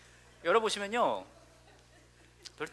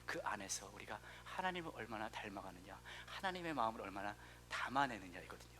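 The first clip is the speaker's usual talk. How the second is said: soft earnest preaching; 7 semitones lower, 12 dB quieter than usual. Acted. Hushed closing prayer.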